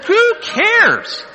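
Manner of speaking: loud, high-pitched dismissal; scornful outburst. Real, not acted. Preacher scorning boasts about countries preached in, scornful outburst. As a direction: throw it out as an exasperated cry.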